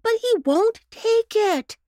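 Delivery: a fussing mother explaining her sick pup; mother dog voice, a touch anxious; worried mother dog. A narrator's reading. Missus Bow Wow's worried lines.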